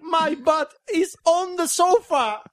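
high, slow hammed-up English; high slow mock voice; mock swagger. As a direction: boast like a cartoon rapper.

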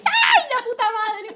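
high, loud cursing wail; real high-pitched cry of dismay; exasperated outburst. Real, not acted. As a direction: squeal the curse as plans get interrupted. Friends arrive while they are recording.

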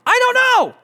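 high, loud imitation of panic; freaking out. Wife cannot name her street, freaking out. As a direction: burst out in a mock frantic wail. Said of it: "she's freaking out".

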